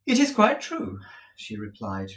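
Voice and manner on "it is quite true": high, cold contempt; contemptuous. A narrator's reading. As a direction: say it with utter contempt. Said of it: "with the indifference of utter contempt".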